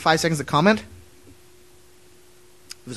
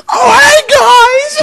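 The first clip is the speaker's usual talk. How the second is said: high, blaring mock shout; overloud greeting; 14 dB louder, much slower than usual. Host demonstrates being far too loud on mic, high blaring shout. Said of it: "or in the mind's be like".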